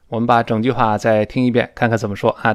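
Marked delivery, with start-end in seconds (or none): none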